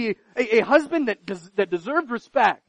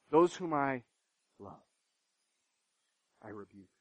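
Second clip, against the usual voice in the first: much softer and lower, almost breathed out; hushed repetition; 10 semitones lower, 10 dB quieter than usual. Shown as a demonstration. Preacher repeating God's words to the congregation, hushed. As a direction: let it fall to a near hush. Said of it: "same words repeated softly".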